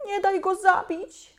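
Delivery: put-on high pleading little boy; youngest brother. A narrator's reading. Youngest boy begging mother to spare the beast, high pleading. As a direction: beg like a small child. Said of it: "powiedział nasz najmłodszy brat".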